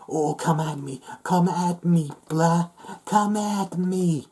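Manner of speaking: high, drawn-out mock taunt; mock big man. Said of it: "trying to be a big man".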